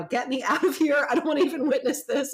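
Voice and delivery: mock panic, giggling to get away; embarrassed mom escaping, laughing; laughing embarrassment. Mortified mom fleeing scene, laughing.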